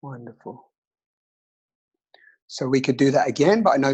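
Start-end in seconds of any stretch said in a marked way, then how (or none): 0.0-0.7 soft whispered meditation; whispering. Acted. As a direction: breathe the words gently.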